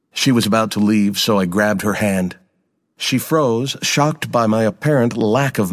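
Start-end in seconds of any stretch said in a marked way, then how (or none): none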